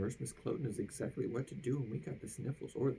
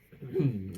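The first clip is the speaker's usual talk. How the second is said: throat clear; 4 semitones lower, 7 dB louder than usual. Acted. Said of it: "He cleared his throat".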